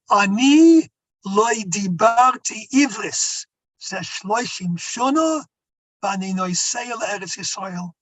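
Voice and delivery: put-on Hebrew in an old European accent, slow. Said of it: "In a European accent".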